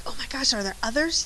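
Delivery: hushed, softly gushing student excitement; mock excitement. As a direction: play an excited student, kept quiet. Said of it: "you're probably really excited".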